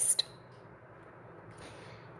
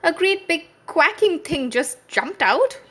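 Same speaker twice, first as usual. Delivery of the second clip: much higher, louder frightened report; scared cry; 11 semitones higher, 5 dB louder than usual. A narrator's reading. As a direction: blurt it out in fright. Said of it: "he cried".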